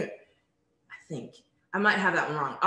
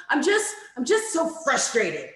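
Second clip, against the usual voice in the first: put-on frustrated girl; 5 semitones higher, 3 dB louder than usual. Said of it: "She was like".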